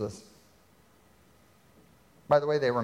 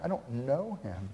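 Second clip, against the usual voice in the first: softer, muttered denial; quiet nervous denial; hushed denial; 6 dB quieter than usual. Retold or imitated. Peter's denial replayed softly.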